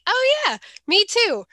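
high, bright "oh yeah, me too"; mock eager agreement. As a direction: squeal it like total agreement. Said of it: "There's very rarely that we are like".

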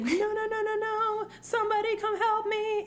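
high, thin plea at normal volume; mock inner scream. Bride screaming in her head at the altar, mock panic. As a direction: squeal for help in pretend panic. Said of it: "She's, like, screaming in her head".

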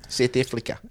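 South African accent with every vowel pushed towards E. Shown as a demonstration. Friend showing the vowel trick, mock South African accent. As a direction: softly demonstrate an exaggerated South African accent. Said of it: "every vowel is E".

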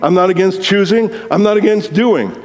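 ordinary delivery

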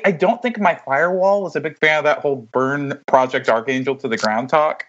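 usual speaking voice

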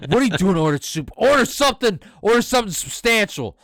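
loud high exasperated demand; mock dad scolding. Retelling his friend's dad scolding him over soup, loud and high. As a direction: bark it like a fed-up father.

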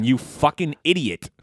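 ordinary talk

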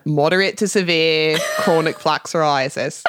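practised Australian accent phrase; attempted Australian pronunciation. Shown as a demonstration. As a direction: find your way into an Australian accent. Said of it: "my phrase that gets me into it".

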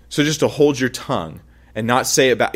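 ordinary talk

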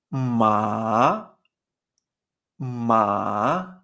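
"ma" dropping low, then rising; dipping third tone. Shown as a demonstration. Teacher modelling the third tone, "ma" low then rising. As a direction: sink low on "ma" and swing up. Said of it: "se pronuncia de esta manera".

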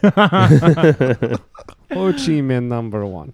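lightly put-on Russian voice; John McCain. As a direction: joke Russian accent. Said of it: "Can you do Soviet John McCain".

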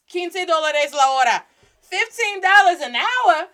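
loud, high-pitched disbelief; incredulous. Real, not acted. Former shift manager hearing today's wages, incredulous. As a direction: exclaim the wage like it's outrageous.

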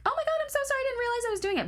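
very high, gushing mock apology; mock apologetic customer. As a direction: squeal it like a flustered customer. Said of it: "they were like".

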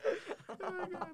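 drawn-out high "oh my God"; slow, high and stunned; amazed. Real, not acted. Reacting to banana joke.

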